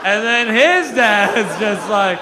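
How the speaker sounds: "his" stretched long with a swooping rise; drawn-out stress on HIS. Real, not acted. Comic going back another generation of dads, "his" loudly stretched. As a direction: lean hard and loud on "his", dragging it out. Running bit, escalating lead-in.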